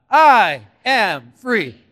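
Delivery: "I am free" loud and very slow; loud slow declaration. Shown as a demonstration. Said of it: "Nice and loud".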